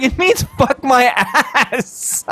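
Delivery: high, slow, drawn-out yell breaking into laughter; grandpa yelling. Retold or imitated. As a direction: imitate outraged grandpa, stretching the words. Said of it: "He starts yelling".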